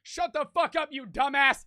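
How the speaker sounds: high-pitched mock yell; retold yelling. Old man yelling at the friend, mock yell. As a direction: imitate a yell without full volume. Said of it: "just starts yelling at him".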